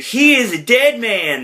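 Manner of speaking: high, loud, drawn-out declaration; mock worked-up vow. Retold Garth agreeing to kill her husband, worked-up vow. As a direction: slowly proclaim it in mock bravado.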